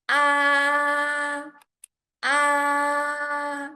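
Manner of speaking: "Ah" held long on a high, level pitch; first tone. Shown as a demonstration. Teacher showing learners the first tone, "Ah" held high and level. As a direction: sustain "Ah" high and steady from start to end. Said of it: "it starts high and maintain high".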